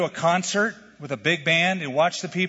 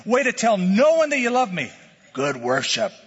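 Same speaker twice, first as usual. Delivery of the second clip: mocking praise, rising higher and louder; sarcastic praise; 5 semitones higher, 4 dB louder than usual. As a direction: pile on the sarcasm as God. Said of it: "Think God says".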